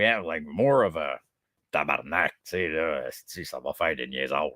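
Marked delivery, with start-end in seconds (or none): 1.7-4.5 low, rough Quebec French swearing; rough Quebec accent. Shown as a demonstration. Quebecer contrasting home talk with European French, rough Quebec accent. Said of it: "it's, like, rough".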